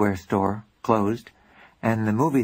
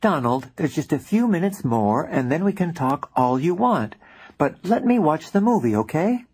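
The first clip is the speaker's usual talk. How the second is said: irked; 6 semitones higher than usual. Acted. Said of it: "I was irked".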